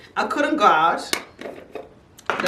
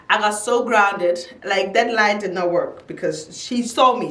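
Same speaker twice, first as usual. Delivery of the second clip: normal talking voice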